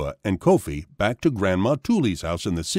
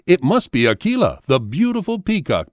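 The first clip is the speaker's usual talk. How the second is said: raised-pitch storybook exclamation; mild scream; 7 semitones higher, 3 dB louder than usual. A narrator's reading. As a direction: cry out higher, short of a real shout. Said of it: "Binta screamed again".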